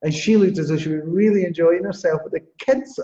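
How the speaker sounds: ordinary talk, nothing marked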